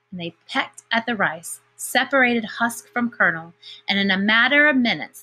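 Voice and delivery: usual speaking voice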